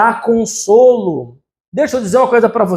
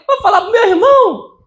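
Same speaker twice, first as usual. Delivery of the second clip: put-on child's threat; 10 semitones higher than usual.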